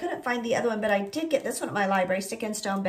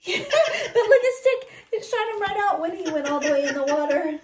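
laughing through the words, higher and louder; 10 semitones higher, 4 dB louder than usual. Real, not acted. Host laughing at the picture with a child: laughing high. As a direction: giggle while pointing out the picture.

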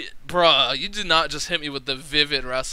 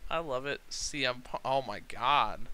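ordinary talk

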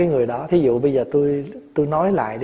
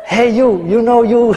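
slow, higher, louder, hammed-up call to the husband, laughing; mock phone call; 8 semitones higher, 6 dB louder, slower than usual. Monk pretends to phone the husband, laughing, hammed-up. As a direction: tease it out slowly through a laugh. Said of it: "Phone cho ổng liền".

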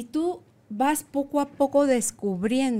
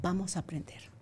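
quiet whisper of reassurance; soft whispered encouragement from the little girl inside; gentle reassurance; 3 semitones lower, 3 dB quieter than usual. Retold or imitated. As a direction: comfort yourself softly, like an inner child. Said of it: "ella es la que me dice".

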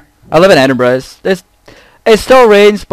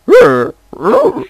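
growled "Rrr" then a much higher "That looks good"; grunting Scot; 13 semitones higher, much slower than usual. Retold or imitated. Mocking Scots who fry everything, growl and high squeak. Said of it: "It's just like".

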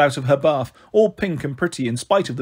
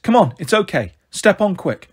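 ordinary delivery

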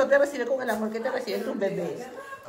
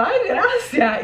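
higher, slow, coy thanks; flattered thanks; 10 semitones higher, 5 dB louder, much slower than usual. Retold or imitated. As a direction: act flattered. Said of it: "me sentí muy halagada". (imitando un gracias halagado, lento y agudo)

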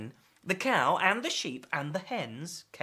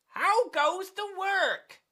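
high, drawn-out call; singsong farmer's holler, pitched high; 12 semitones higher, 3 dB louder, slower than usual. Acted. Farmer calls to duck repeatedly.

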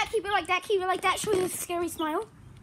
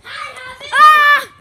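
startled yelp; 5 semitones higher, 14 dB louder than usual. Real, not acted. Startled by something on screen.

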